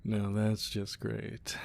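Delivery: slow, flat-sounding sarcasm; sarcastic, slow and soft; dry sarcasm. Acted. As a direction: drag it out, dripping sarcasm. Sarcastic reaction to bad news.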